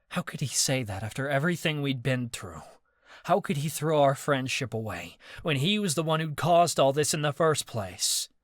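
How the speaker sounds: plain reading voice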